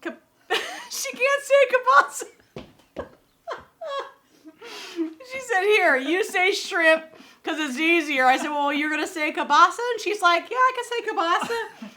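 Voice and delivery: high and loud, laughing retelling. Real, not acted. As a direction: tell it while cracking up. Retelling her boast, laughing.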